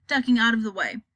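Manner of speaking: ordinary delivery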